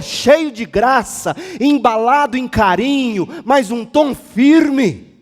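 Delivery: loud, firm preacher, pitched high; slow down and drive each word home; forceful pulpit delivery; full-force preaching. Real, not acted.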